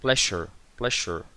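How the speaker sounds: "Pleasure" slowly, zh sound; "pleasure" spoken slowly as a model; model pronunciation. Shown as a demonstration. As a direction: draw out "pleasure". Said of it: "que termina en sure".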